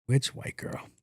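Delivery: whispered under the breath; whispered aside. Real, not acted. Host wondering aloud to herself, whispered. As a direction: muse it in a whisper.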